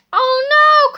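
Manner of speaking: high, loud panicked cry; alarmed cry. Acted. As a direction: cry out in dismay. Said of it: "cried Harold".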